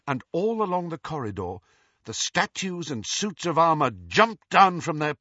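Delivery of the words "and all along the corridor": normal talking voice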